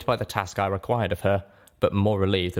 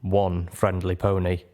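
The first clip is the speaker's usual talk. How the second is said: ordinary delivery, nothing marked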